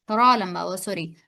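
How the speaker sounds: ordinary talk, nothing marked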